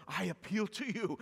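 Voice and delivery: I appeal to you as an imploring cry; pleading appeal. Retold or imitated. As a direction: beg them like a man on his knees. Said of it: "Paul getting on his knees and pleading".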